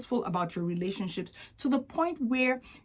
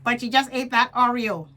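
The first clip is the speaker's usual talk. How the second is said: mild mocking jab; a hint of a smug scolding voice; inner critic; 3 semitones higher, 4 dB louder than usual. Inner critic mocking the dieter, light sneer. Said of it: "Inner critic".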